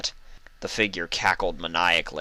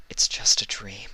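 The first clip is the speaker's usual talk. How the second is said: soothing whisper; 2 semitones higher than usual. Acted. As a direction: hush her back to calm. Celestia soothes Luna.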